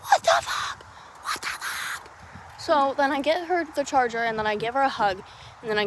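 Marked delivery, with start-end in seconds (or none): 0.1-0.8 very high squeal of disbelief; aghast squeak. Real, not acted. Storyteller reacting to the whole caiman ordeal, high squeal. 1.2-2.1 voiceless whisper of disbelief; aghast whisper. Real, not acted. Storyteller reacting to the whole caiman ordeal, whispering.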